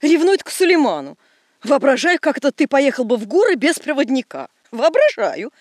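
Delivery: loud, indignant scolding. Acted. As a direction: snap back, offended. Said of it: "возмутилась дамочка".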